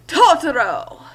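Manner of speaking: loud triumphant announcement; a big dramatic Totoro reveal. Acted. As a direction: shout the name like a grand reveal.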